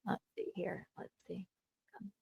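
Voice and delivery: soft unvoiced muttering to self; whispering. Real, not acted. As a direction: whisper it while setting up the clip. Streamer mutters while setting up.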